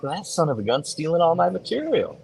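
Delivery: high-pitched playful indignation; hammed-up grievance without extra volume; mock outrage. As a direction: complain in joking, high-voiced outrage. Mock outrage at being copied.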